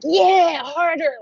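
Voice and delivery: high, loud taunt; goading yell. Retold or imitated. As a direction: urge your attacker on loudly. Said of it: "like that".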